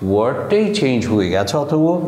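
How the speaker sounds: ordinary talk, nothing marked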